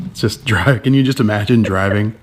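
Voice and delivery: ordinary talk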